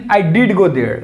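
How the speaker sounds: "did" carries the weight, falling after; emphatic "did"; stress on DID. Shown as a demonstration. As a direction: lean on "did" to insist. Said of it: "But here using".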